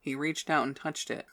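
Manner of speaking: ordinary delivery, nothing marked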